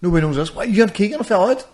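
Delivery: high, incredulous questioning; mock astonishment. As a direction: react in disbelief, pitch jumping up. Acts out astonished interviewers' reaction.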